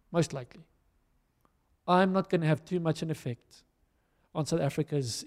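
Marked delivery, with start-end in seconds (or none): 0.1-0.6 "most likely" dropped softer, a quiet echo of the line before; quieter repeat. Shown as a demonstration. Preacher conceding to the church, "most likely" repeated softer. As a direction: take it down and let "most likely" fall away softly. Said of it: "But most likely".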